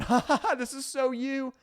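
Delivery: high mock laugh; high teasing laugh. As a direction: cackle at them teasingly. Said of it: "tag it with a laughing face going".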